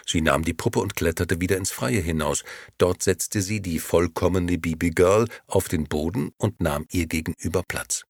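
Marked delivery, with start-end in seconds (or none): none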